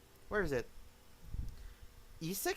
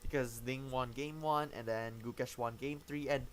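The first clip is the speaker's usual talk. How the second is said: ordinary delivery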